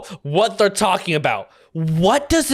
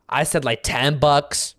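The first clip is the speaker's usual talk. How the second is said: ordinary talk, nothing marked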